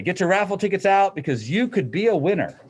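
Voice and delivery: a touch of a cheery PA announcer; mock announcer. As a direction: hype it up like the school intercom. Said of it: "they're gonna say".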